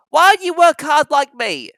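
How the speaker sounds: high, loud teasing engine voice; cheeky little engine. Acted. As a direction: taunt the sleepy big engine. Thomas teasing big engine.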